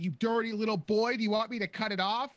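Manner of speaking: high, loud scolding; mock yelling. Actress yelling at Anakin in the scene, mock scolding. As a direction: yell it in an imitation of her outrage. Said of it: "She starts yelling".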